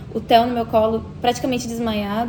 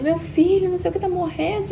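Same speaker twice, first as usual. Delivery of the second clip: retold desperate cry, held back; imitated crying shout; 7 semitones higher than usual. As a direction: imitate a panicked, tearful father. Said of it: "E ele gritando, chorando".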